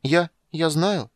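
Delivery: high, slow, hesitant stammer; faltering. A narrator's reading. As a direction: stumble over the words, unsure. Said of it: "замялся он".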